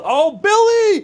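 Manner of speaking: loud desperate yell for a teammate; man down shout. Real, not acted. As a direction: cry out his name in alarm. Teammate down in game.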